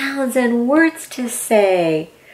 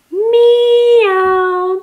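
high, louder drawn-out meow; cat meow; 11 semitones higher, 5 dB louder than usual. Shown as a demonstration. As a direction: show the child a big kitty meow. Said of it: "Let me hear you say it".

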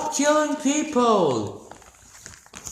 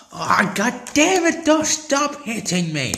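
frustrated yell; 4 dB louder than usual. Real, not acted. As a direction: yell as you get hit. Getting hit.

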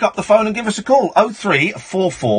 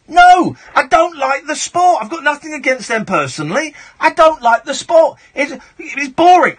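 higher, emphatic irritated protest; real annoyance; 6 semitones higher than usual. Real, not acted. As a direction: push back hard, fed up. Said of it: "it really annoys me".

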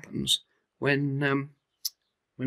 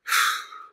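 sigh. Acted. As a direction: let out a small unhappy breath. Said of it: "Bobo sighed".

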